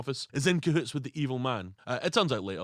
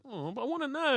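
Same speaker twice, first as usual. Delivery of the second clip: mock whiny demand; 12 semitones higher than usual. Mocking the wife demanding cash.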